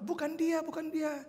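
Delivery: high, pleading denial; frightened child. Acted. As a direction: deny it like a scared child. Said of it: "anak baik ini kok mau menangis". (anak ketakutan menyangkal)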